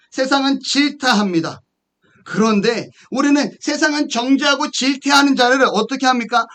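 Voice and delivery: high, insistent preacher's cadence; emphatic preaching. Acted. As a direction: drive each point home from the pulpit. Preaches with force.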